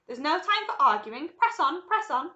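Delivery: high, loud urging; urgent command. Acted. Wonka hurries everyone past the doors, urgent and loud. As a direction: cut the argument short and push on.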